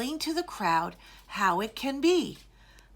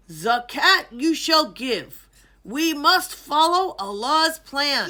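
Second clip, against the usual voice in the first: royal decree; 3 semitones higher, 6 dB louder than usual. A narrator's reading. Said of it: "the king decreed".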